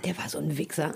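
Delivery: hushed whispered insult; whispering. Real, not acted. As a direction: drop to a whisper.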